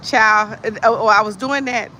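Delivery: normal talking voice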